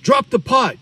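imitating a cop shouting an order; mock cop shout. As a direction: bark it like a panicked cop giving orders. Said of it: "now they're like".

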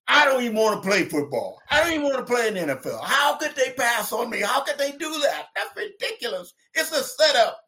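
high-pitched, indignant rant; retold upset protest. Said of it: "I'm out there, I'm crying".

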